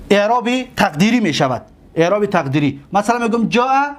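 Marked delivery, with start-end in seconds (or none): none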